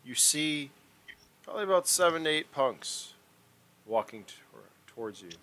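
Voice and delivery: a touch of dramatic scene setting; game master narration. A narrator's reading. Game master describing the approaching punks, lightly dramatic. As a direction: set the scene for the players.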